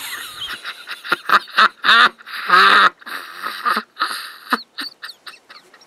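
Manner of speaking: loud maniacal cackle; booming evil laugh; villain laugh. Acted. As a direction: cackle like a madman bursting in. Villain entering, wild cackle.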